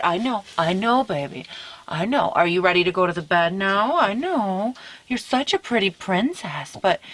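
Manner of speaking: tender soothing. Real, not acted. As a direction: soothe a clingy little pet. Comforting her clingy dog.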